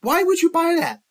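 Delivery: wife asking her husband why he bought that, in a high put-on voice; exasperated wife. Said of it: "his wife looks at him and goes".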